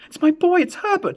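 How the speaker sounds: frantic cry. A narrator's reading. Mother struggling in her husband's grip, frantic cry. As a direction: cry out, struggling to get free. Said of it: "she cried".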